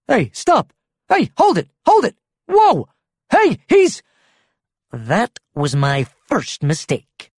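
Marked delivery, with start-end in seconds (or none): 0.1-4.0 high, loud, short bursts of alarm; startled, high-pitched shouting; alarmed protest. A narrator's reading. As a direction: cry out to make him stop. Friend turns out dangerous.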